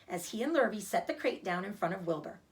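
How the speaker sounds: usual speaking voice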